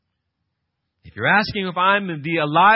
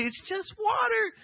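high, shouted, insisting it's nothing; John insisting to the crowd it is only water, a high shout; emphatic shout; 4 dB quieter than usual. Retold or imitated. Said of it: "bringing himself back down again to say".

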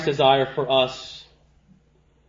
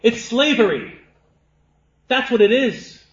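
raised, forceful pulpit voice; impassioned preaching; 9 semitones higher, 7 dB louder than usual. Real, not acted. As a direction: preach it with conviction, high and punchy.

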